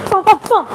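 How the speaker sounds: high-pitched rapid "boom" for each punch; punch-combo sound effects; imitated punch sounds. Shown as a demonstration. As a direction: voice the punches as three booms. Calling out punches in a demo.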